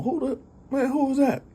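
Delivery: high, alarmed who's there; startled. Retold or imitated. Girl hearing tapping at her window, high and startled. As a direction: jump at the noise, voice shooting up.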